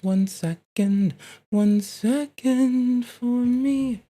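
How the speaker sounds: high, slow sing-song stalling; drawn out playful singsong. Pretend. Sing-song stalling while working.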